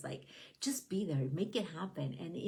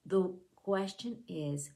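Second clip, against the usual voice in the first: ordinary talk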